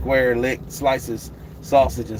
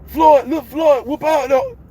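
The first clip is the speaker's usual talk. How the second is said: put-on much higher, louder shouting old trainer voice; Floyd Senior impression; 7 dB louder than usual. Doing Floyd Senior for the stream, a high loud boastful yell. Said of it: "Oh, Floyd Senior".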